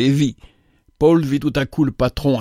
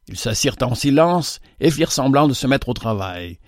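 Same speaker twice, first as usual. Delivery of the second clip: plain reading voice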